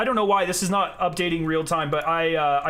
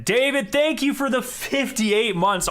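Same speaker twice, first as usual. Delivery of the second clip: excited donation thanks; 8 semitones higher than usual. Real, not acted. Thanking a subscriber.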